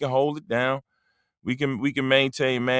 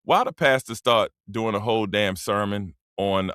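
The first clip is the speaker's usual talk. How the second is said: ordinary talk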